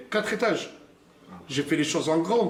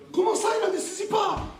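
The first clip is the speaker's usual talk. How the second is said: retold shout; 12 semitones higher than usual. Father learning his baby gets no anaesthetic, high outraged shout. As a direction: shout it in disbelief. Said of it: "Je commence à crier".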